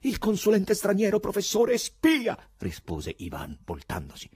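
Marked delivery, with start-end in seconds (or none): 0.0-2.3 high, loud accusation building up; high-pitched, mounting outcry; agitated shout. A narrator's reading. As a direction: fire back loudly, peaking on the last word. Ivan shouts back, agitated.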